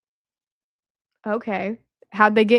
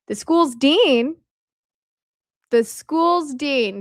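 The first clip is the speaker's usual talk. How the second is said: "The school's dean" drawn out slowly, pitched higher; suspenseful emphasis; 4 semitones higher, much slower than usual. Real, not acted. Speaker teases viewers before naming the dean, suspenseful emphasis. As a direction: repeat "the school's dean" slowly, pausing between.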